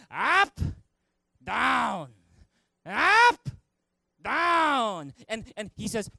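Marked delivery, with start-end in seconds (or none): 0.1-5.1 loud, high, stretched drill calls; hammed-up master barking brush strokes; Mister Miyagi. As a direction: call each stroke out with a pause between. Acting Miyagi's painting drill.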